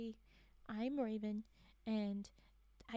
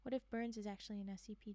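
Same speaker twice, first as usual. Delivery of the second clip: ordinary talk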